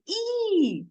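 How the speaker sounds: высокий протяжный і sung out for the class; long drawn out і. Shown as a demonstration. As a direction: stretch the sound і high and long. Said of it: "Вимовіть, будь ласка, І".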